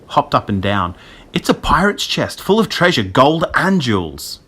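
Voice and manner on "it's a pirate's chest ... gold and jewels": high-pitched thrill at finding treasure; excited kid discovering pirate treasure. Acted. Jack hops at finding treasure.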